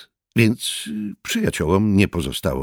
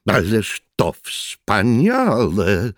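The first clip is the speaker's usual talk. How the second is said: louder, very slow cry of delight; slow delighted exclamation; 5 semitones higher, 5 dB louder, much slower than usual. A narrator's reading. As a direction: relish it, delighted. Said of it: "zawołał dziwny przybysz".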